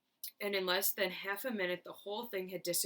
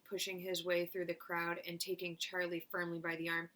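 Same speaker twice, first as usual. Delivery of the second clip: ordinary delivery, nothing marked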